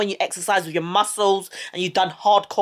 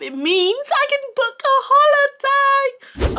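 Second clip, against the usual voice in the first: excited squeal; 3 dB louder than usual. Real, not acted. Vlogger announcing holiday after second jab, excited squeal. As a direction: burst upward into a gleeful high cry. Celebrates being able to travel.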